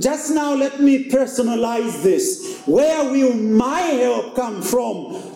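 a pastor preaching at full stride, rising and falling. Acted. Pastor preaching to the congregation, earnest. Preaching a sermon on Psalm 121.

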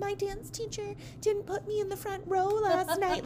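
mock teen. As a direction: play a teenager complaining online. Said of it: "Teens are gonna post about things like".

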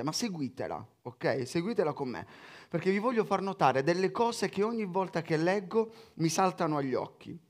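ordinary talk

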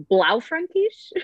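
"Blaufränkisch" slowly and high, sounded out; tentative pronunciation. Shown as a demonstration. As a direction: attempt "Blaufränkisch" carefully as BLAU-frenk-ish. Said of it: "I'll try to pronounce the grape varietals".

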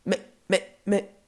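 stammered, separated protests; lost for words. Acted. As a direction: sputter as if dumbfounded. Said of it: "Grognon ne trouve même plus ses mots".

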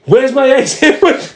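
high, slow mock-furious demand; steaming mad wife. Wife waiting at the back table demands breakfast, mock fury. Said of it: "my wife fucking steaming mad".

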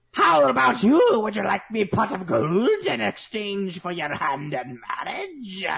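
high pleading leprechaun voice. Pretend. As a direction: beg in a high little leprechaun voice. Leprechaun offers pot of gold.

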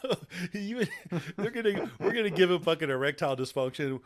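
cracking up while mocking the writers; laughing. Real, not acted. Ranting to friends about the movie, laughing.

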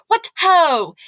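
loud, high battle cry; shouted. Retold or imitated. Teacher in Roman armor challenging the class, loud hearty call. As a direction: bellow it like a knight charging. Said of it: "and shouted".

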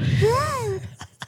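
real helpless squeal of laughter; high squealing laugh. Real, not acted. Too high to go on, high squealing laugh.

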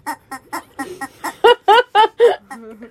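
long squawking laugh, like a chicken; real cackling laugh like a rubber chicken. Real, not acted. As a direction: laugh helplessly in clucking bursts. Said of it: "I sounded like the chicken".

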